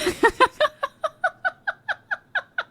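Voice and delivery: a squealing fit of laughter, high and long; high shrieking laugh. Real, not acted. Streamer cracking up at an absurd manga plot, squealing laugh.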